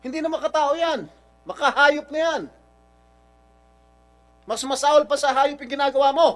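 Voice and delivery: high, outraged condemnation. Real, not acted. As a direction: condemn the witness in a high, angry voice. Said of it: "raising my voice". (galit na pagkondena, mataas ang boses)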